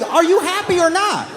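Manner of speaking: high, laughing question; a squealing, laughing taunt; mock excited question. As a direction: ask it gleefully high. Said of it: "I'm like".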